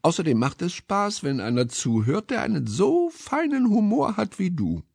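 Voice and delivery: high-pitched, complimentary mouse; flattering mouse character. A narrator's reading. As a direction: flatter sweetly as a small mouse.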